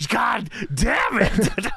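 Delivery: slow, drawn-out mock yell of god damn it; mock angry yell. Vince yelling backstage at his announcer, slow mock outburst. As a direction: bellow it like a fed-up boss, slow.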